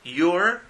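bright model of your; your with a rising tune; model pronunciation. Shown as a demonstration. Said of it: "Number sixty".

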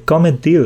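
"Com et dius?" modelled in Catalan for repeating; Catalan demo. Shown as a demonstration. Teacher asks the learner to repeat "Com et dius?".

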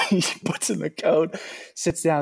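laughing while talking. Real, not acted. Amused retelling, laugh heard.

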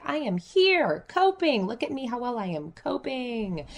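high, smug showing off; lightly mocking someone bragging about coping; sing-song mock pride; mock proud coper. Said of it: "coping is like".

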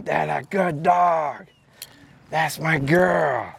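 low, slow praise to the dog; gentle low praise; calm praise. Retold or imitated.

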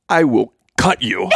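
mock menace, voice dropped from the gush; playful cutthroat warning; threatening mode two. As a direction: imitate her deadpan threat. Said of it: "Mode number two is".

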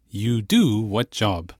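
slow, word by word; slow literal gloss. Shown as a demonstration. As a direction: lay out each word slowly. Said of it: "which literally means".

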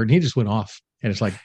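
ordinary talk, nothing marked

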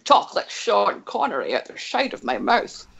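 slurred "sh" in a Scottish burr; Sean Connery. Shown as a demonstration. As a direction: do a party-piece impersonation of the actor. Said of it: "the only impersonation I can do".

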